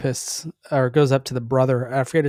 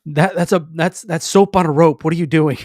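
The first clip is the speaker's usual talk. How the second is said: louder, higher imitation of an indignant objection; mock protest; 3 semitones higher, 7 dB louder than usual. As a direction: blurt it out as a retold protest. Acting out a film character's protest.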